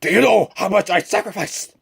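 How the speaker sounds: quoting the movie villain's indignant outburst at the board; imitating Norman Osborn. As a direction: throw it out like the wounded tycoon standing up. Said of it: "He just stands up and he goes".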